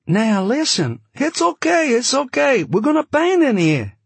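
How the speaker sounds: put-on high, loud voice, light Yiddish accent; slight Yiddish accent. A narrator's reading. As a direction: press him in a high Yiddish-tinged voice. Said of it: "He had a slightly Yiddish accent".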